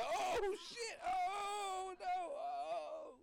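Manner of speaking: high hammed-up wail of horror; mock cry at a Skeletor face; horrified oh no. Driver seeing her face up close, hammed-up horrified wail. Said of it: "You be like".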